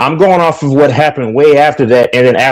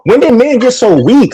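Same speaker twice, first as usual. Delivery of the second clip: exasperated; 7 semitones higher than usual. Real, not acted. Heated debate, exasperated.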